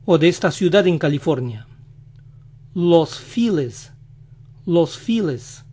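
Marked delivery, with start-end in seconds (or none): 2.7-5.6 "Los Files" as "los FEE-les"; slow American "Los Files"; English pronunciation. Shown as a demonstration. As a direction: Feliz anglicized, stressed "FEE", in "Los Files". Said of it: "lo dicen".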